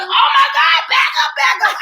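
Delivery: high, loud panicked yell; panicked shout. Retold or imitated. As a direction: yell at the driver to back up. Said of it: "She's like".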